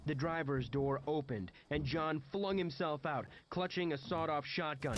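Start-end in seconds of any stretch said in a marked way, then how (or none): none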